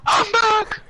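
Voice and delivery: imitating a blaring shout, high and loud; loud mock shout of a friend returning. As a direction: yell it like a sudden jump scare. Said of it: "That's what I get".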